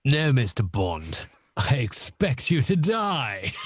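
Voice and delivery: slow, menacing villain read; drawn-out, theatrical Bond villain. Acted. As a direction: relish the threat like a movie baddie. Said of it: "as if he was a Bond villain".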